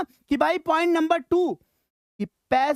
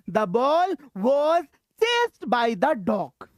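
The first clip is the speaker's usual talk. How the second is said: slow reading; much slower than usual. Shown as a demonstration. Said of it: "teacher reads example sentence".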